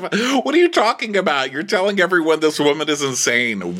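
high, heated mock protest; imitating Kyle's exasperation. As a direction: burst out in hammed-up disbelief. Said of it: "And Kyle's like".

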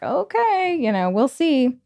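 a hint of doubtful publisher; unimpressed publishers. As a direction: sound politely unconvinced. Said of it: "everyone's just kinda like".

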